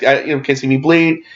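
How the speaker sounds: ordinary talk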